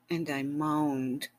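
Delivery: plain reading voice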